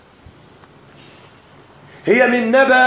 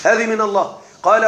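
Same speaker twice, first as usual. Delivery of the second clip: ordinary talk, nothing marked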